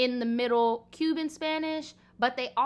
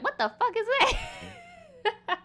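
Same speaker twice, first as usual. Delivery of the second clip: high-pitched, drawn-out baffled outburst breaking into laughter; incredulous reaction dissolving into a laugh; laughing disbelief; much slower than usual. Retold or imitated. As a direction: squeal it in mock bewilderment, cracking up.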